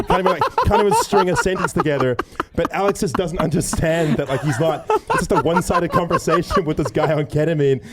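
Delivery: high-pitched, giggly storytelling; laughing while talking. Real, not acted. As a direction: tell it through real laughter. Cracking up while telling the story.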